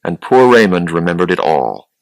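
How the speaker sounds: ordinary delivery, nothing marked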